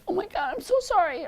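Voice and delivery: high, mock-tearful pity. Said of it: "start crying and be like".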